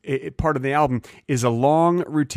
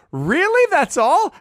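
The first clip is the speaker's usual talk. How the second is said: high, loud disbelieving squeak; a kid who can't believe the price, way up high; shocked kid; 5 dB louder, slower than usual. Retold or imitated. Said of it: "And I was like".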